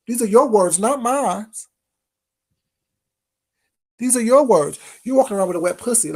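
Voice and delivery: loud and cutting; emphatic. Real, not acted. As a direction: hit "your" hard, loud and accusing. Throwing her words back.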